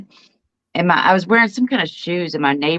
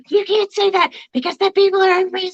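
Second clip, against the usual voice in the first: scolding grandma; 10 semitones higher than usual. Retold or imitated. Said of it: "she launched into me".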